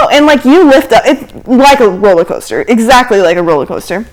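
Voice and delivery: normal talking voice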